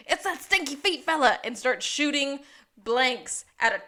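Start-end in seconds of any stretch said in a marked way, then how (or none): 0.1-1.4 loud, higher, alarmed cry; a hammed-up alarm; mock panicked outburst. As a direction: yell it like a spooked gunslinger. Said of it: "and goes".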